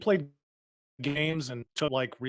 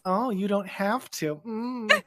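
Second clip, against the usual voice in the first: teasing imitation; 6 semitones higher, slower than usual. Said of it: "I can hear it in your voice".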